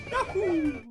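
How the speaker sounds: high, drawn-out cartoon whoop; Mario's yahoo. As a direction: imitate a video game hero's gleeful yell. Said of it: "he screams and he goes".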